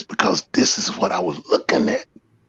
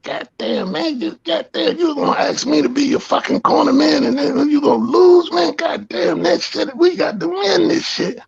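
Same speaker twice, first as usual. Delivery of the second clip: higher, half-shouted pep rant; firing up his corner man, raised pitch; retold fired-up rant. Scolding Perry Powell before the fight, fired-up rant.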